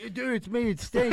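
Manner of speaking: high, laughing-edged plea; giddy confession. Retold or imitated. Guy caught in the tub, high and giddy. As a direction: own up with sheepish glee.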